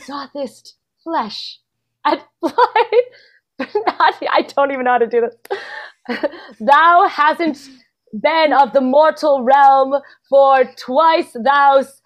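slow, hammed-up stage verse; slow faux-Shakespeare recitation, halting and fumbling; mock Shakespearean. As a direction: declaim it like a bad Elizabethan actor. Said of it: "Make it Shakespearean".

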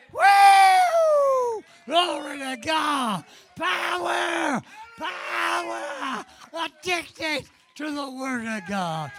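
shouted, high ecstatic praise; ecstatic preaching. Acted. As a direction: whoop it up, full revival fire.

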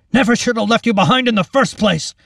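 loud, high, impassioned outburst; anguished shout. A narrator's reading. Captain to the woman he left behind, anguished shout. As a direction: shout it in urgent regret. Captain berates himself amid fire.

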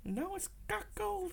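high, slow squeaky woman voice; high falsetto weather girl. Pretend. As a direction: squeak it out slowly as a lady presenter. Why did Halima sound just like you.

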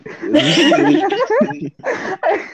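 nervous laugh. Real, not acted. Nervous laughter before podcast.

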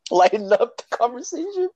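high voice squeezed by laughter; laughing. Real, not acted. Friend lightening the chat with a joke, laughing. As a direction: talk through giggles.

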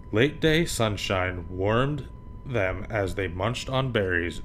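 usual speaking voice

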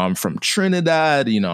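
normal talking voice

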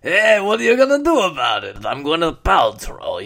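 loud mock Transylvanian accent, high then dropping; Gwyneth Paltrow. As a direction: belt it in a hammed-up thick accent. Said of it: "and goes".

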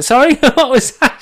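high, loud disbelief with a laugh; baffled trainer. Retold or imitated. Trainer hears the breed claim, baffled.

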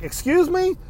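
very high, rising challenge; squeaked, incredulous retort; acted-out affronted disbelief. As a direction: throw it back in mock disbelief. Acting out his affronted reply.

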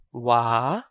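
"wa" with the A drawn out long; a prolonged, open "wa"; long vowel. Shown as a demonstration. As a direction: hold the vowel in "wa". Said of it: "long vowel a".

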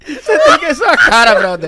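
loud slow laughing words. Real, not acted. Laughing at friend's face.

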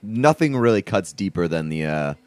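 ordinary talk

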